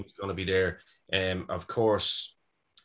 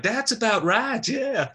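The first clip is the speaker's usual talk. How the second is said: very high, slow mock wife; imitating the wife; 14 semitones higher, 3 dB louder, slower than usual. Wife agreeing with the caller, high-pitched mimicry. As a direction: agree in a high put-on American voice. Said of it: "she was like".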